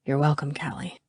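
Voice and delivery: low, soft reply; intimate murmur. A narrator's reading. Garrett answers Callie nose to nose, murmuring low. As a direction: say it only for the person close by.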